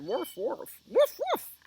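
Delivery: barks jumping high and loud; barking dog. Acted. Storyteller tries out Dog's sounds for children, barking. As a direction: build from woofs to yappy high barks. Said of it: "the dog said".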